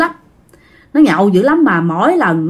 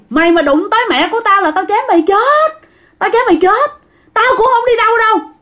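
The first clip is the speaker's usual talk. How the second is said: much higher, frantic threatening voice of a madman; pitched way up, wild and menacing, guarding his mother; crazed uncle yelling; 9 semitones higher than usual. Retold or imitated. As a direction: play the possessed uncle shrieking threats at something unseen. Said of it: "chỉ dao ra ngoài cái cây nhãn mà la".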